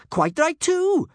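put-on very high, loud, cocky girl's voice; feisty girl. A narrator's reading. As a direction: burst in with loud approval.

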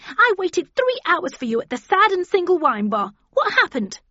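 high, loud, furious tirade; outraged high-pitched yelling; screamed accusation. A narrator's reading. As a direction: shriek it at the lover who stood you up. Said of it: "she screamed".

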